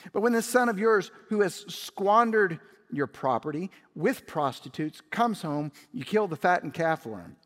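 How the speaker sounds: put-on loud, high indignant older brother; resentful son raising his voice to his father; bitter complaint. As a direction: fling the accusation at the father.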